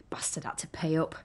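hushed, voiceless whisper; whispered curse. Real, not acted. Woman whispering a bitter curse about her ex. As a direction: spit it out under her breath. Muttered aside about ex-boyfriend.

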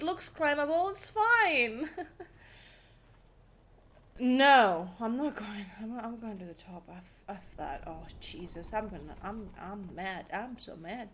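ordinary talk, nothing marked

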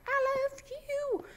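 toy-like high wood voice; voicing the wood. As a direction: give the wood a tiny high voice. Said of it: "wood's gonna be like".

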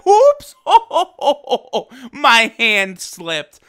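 a cartoonish fake apology through a laugh; mock innocent oops. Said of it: "he's like".

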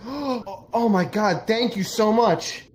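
gasp, then high, gushing thanks; overjoyed gratitude. Acted. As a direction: play overwhelmed relief after a gasp. Door answerer gasps with overwhelming gratitude.

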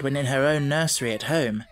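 usual speaking voice

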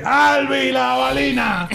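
very slow, high, ringing announcer shout; catchphrase shout. Retold or imitated. Sportscaster showing off his famous call. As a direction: stretch every syllable like a stadium call. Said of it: "es uno a lo que".

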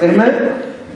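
normal talking voice

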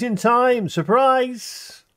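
playful high surprise. Pretend. Jump scare again.

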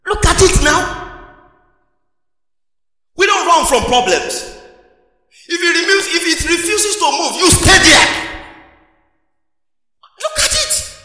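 high, loud, building preacher's cry; high excited sermon shout; excited preaching. Real, not acted.

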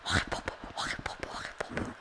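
urgent hushed whisper. Real, not acted. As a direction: whisper so nobody hears. Hiding, not answering the door.

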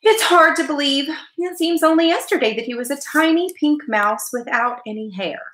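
high-pitched wistful mother, falling away at the end; mother's sentimental lament; sad note. A narrator's reading. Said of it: "with a sad note in her voice".

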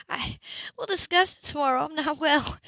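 high, strained plea; begging off, barely holding on; pained. A narrator's reading. Woman with a migraine asking him to stop, strained.

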